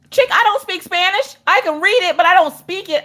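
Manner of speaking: high-pitched defensive protest; mock-indignant squawk; exasperated parent. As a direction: protest high, fed up with questions. Exasperated reply to child.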